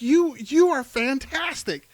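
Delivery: high, loud, starstruck praise; gushing praise. Retold or imitated. Praising Michelle Rodriguez's fight, high and loud. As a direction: rave about her like a fan. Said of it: "I'm like".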